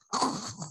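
soft, voiceless wheeze of laughter; breathless laugh. Real, not acted. After apologizing to the boys, soft wheezing laugh.

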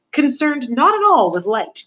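high, slow, drawn-out pronouncement; emphatic creed. Acted. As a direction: stretch it out grandly as the teacher. Mentor states creed for repeating.

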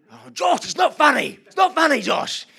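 high, loud mock-stern yell of Oh Josh; shouting at son. Yelling down at giggling son Josh from the scaffold, loud and high. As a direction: snap at the giggling kid from up high.

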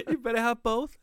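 put-on laughing copy of the Latin accent; copying a Latin mom accent. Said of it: "the fucking accent you give my mom".

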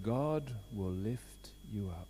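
slow reverent whisper; hushed slow whisper of hope. Acted. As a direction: breathe the promise softly. Tender climax of the sermon.

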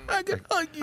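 high, breathy after a gasp; overwhelmed gratitude. Retold or imitated. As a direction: squeak it out, overwhelmed. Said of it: "I was like".

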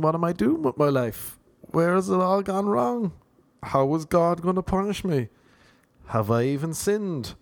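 hammed-up existential worry; mock anxious dread. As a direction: fret aloud over your sins. Acts out Sunday night dread.

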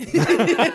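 high burst of laughter; loud high laugh. Real, not acted. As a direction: let out a big laugh. Laughs at own story.

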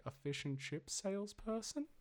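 soft, high, rising guess; mock puzzlement. Podcaster retelling his clueless guess at slang, mildly puzzled. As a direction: imitate a tentative, baffled question.

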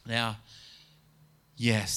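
ordinary delivery

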